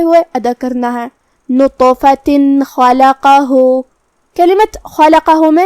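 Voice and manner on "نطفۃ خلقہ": نطفۃ chanted slowly with clear final "tin"; clear tanween n. Shown as a demonstration. Teacher showing izhar, نطفۃ with clear n, slowly chanted. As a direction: end نطفۃ on a plain n, then drawn-out خلقہ. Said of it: "بغیر غنہ سے نون ساکن ظاہر".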